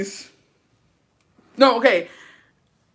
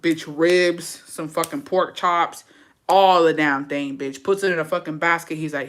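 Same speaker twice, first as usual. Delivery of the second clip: ordinary talk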